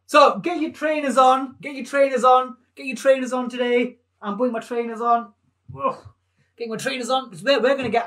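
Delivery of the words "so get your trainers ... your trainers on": loud, high call to the class; rallying call. Real, not acted. Teacher calls out loudly to the children at home. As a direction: get them moving. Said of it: "calls out to class".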